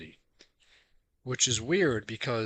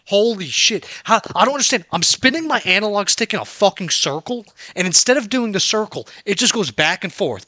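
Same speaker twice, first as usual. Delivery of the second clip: loud, higher, heated ranting; angry rant; 4 semitones higher, 6 dB louder than usual. Real, not acted. Gamer ranting at the analog stick ignoring him, loud angry rant. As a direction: rant in disbelief at the stupid controls.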